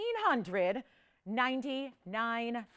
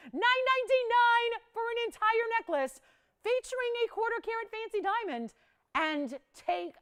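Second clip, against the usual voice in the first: high, loud excited pitch; 12 semitones higher, 6 dB louder than usual. Real, not acted. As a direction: sell it with big excitement. Announcing shock price cut.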